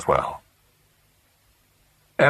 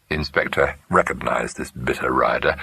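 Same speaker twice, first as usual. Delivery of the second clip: ordinary delivery, nothing marked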